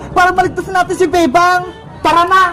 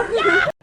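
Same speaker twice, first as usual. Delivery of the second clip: squeaky giggle; 11 semitones higher, 6 dB quieter than usual. Acted. Laugh at the photo.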